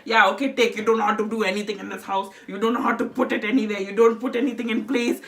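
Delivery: put-on fed-up Indian-accented grandmother; grandmother's nagging in an Indian accent. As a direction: rant wearily in an Indian accent. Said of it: "I'll do the Indian accent".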